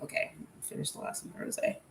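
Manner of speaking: breathy whisper to someone off mic; hushed off-mic whisper; whispered aside. Real, not acted. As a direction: drop to a whisper for a quick aside.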